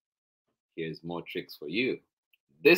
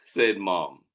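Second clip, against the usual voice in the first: plain reading voice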